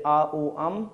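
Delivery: "A, U, Um" as three separate sounds; syllable by syllable Om. Shown as a demonstration. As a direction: split Om into "A", "U", "Um". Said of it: "pronounce it syllabally".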